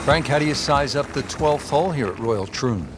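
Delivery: usual speaking voice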